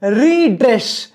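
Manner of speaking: रीड्रेस said slowly as "ree", "dress"; slow two-beat रीड्रेस; split into two syllables. Shown as a demonstration. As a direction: break रीड्रेस after a drawn-out "ree". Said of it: "पहले री और फिर dress".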